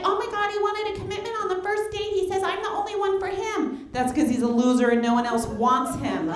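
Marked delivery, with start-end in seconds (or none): 0.0-3.9 gushing friend. Retold or imitated. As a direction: swoon over the new guy. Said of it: "She's like".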